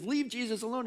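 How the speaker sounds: high-pitched dismissive shushing; scolding crowd. Acted. Everyone tells the blind man to back off, scolding. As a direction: tell him off in a high voice.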